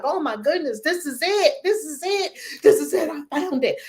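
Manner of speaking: high, building mock thrill; imitating a thrilled believer at church; excited discovery. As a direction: gush like you just found the answer.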